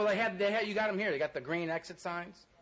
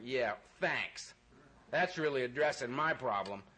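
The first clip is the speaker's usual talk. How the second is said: sarcastic thanks; 6 semitones lower, slower than usual. Retold or imitated. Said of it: "It's like".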